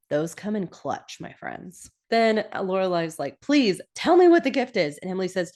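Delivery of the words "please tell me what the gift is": high, loud mock begging; playfully hammed-up begging; pleading. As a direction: imitate an impatient plea for an answer. Retelling Lorelai begging for an answer.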